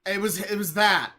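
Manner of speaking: ordinary delivery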